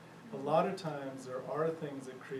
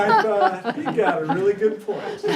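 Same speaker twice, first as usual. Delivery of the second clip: words spoken through real laughter, much louder; laughing; 6 semitones higher, 15 dB louder than usual. Real, not acted. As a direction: chuckle it out loud.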